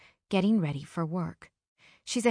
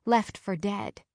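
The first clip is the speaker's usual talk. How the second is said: usual speaking voice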